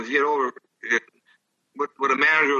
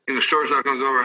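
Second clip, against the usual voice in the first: ordinary talk, nothing marked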